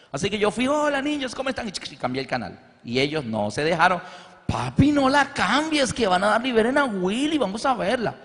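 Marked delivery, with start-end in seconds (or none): 0.7-1.7 much higher, sing-song greeting; feigned innocence. Retold or imitated. Father distracting the children while changing the channel, fake cheerful. 4.5-8.1 put-on much higher childlike pleading; children begging. Kids begging father not to change the channel, childlike pleading.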